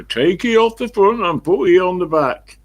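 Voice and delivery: high, loud Somerset shout; Somerset accent. Retold or imitated. Foreman calling to forklift driver, loud Somerset accent. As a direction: holler it in mock West Country, Somerset style.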